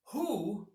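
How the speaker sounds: "who" set apart and lifted high after a pause; stress on who. Shown as a demonstration. Advisor asking clients who can help, "who" stressed high. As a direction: hit "who" alone, high and pointed. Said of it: "emphasis on who".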